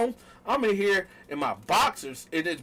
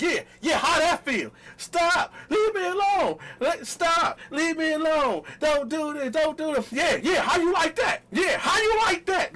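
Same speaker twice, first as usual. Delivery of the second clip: taunting shout; 10 semitones higher than usual. Retold or imitated. Taunting while she pleads.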